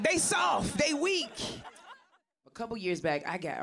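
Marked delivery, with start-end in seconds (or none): 0.0-1.3 mock Trump shout. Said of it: "he be like".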